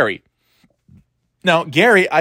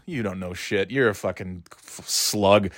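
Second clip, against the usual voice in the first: much softer, low contemptuous aside; muttered insult; 5 semitones lower, 7 dB quieter than usual. Real, not acted. An aside to the audience about the coworker, muttered. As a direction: sneer it under your breath.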